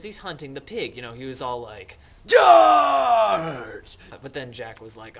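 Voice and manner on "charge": high, drawn-out hammed-up war shout; battle cry. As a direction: bellow a mock cavalry charge. Mock battle cry of Jack.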